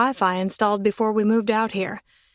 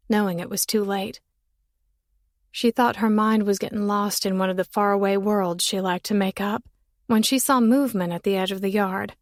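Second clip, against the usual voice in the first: usual speaking voice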